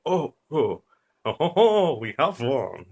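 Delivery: high, loud mock French exclaiming; bad French accent. Imagined con men celebrating their find, in a poor French accent. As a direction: crow it in a hammed-up French accent. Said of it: "it's a shite French accent".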